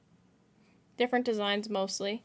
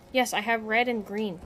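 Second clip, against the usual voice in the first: usual speaking voice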